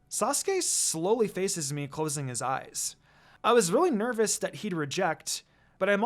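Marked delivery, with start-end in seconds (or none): none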